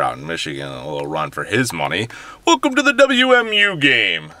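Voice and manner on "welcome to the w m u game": big booming game-day announcer; voiceover announcer. Shown as a demonstration. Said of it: "I would be a voiceover announcer".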